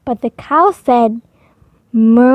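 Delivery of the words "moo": a loud cow's moo. A narrator's reading. The cow moos loudly at the fox. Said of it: "so loudly that the fox was frightened".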